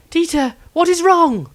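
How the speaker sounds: high, loud anxious cry to a hurt friend; worried. Acted. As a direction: call out to him, fearful for him. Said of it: "inquired worriedly".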